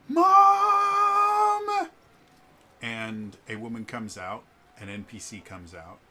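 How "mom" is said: put-on long, very high, loud child's wail; kids yelling for mom. Both kids calling their mother outside, shrieky drawn-out yell. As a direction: holler it high and stretched like a tattling kid. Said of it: "they both just go".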